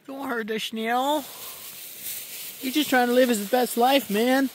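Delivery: high, pleading for the snail; high-pitched pleading. Real, not acted. Defending the snail playfully.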